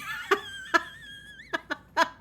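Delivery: a high, bursting laugh. Real, not acted. Streamer laughing at her partner's staircase joke.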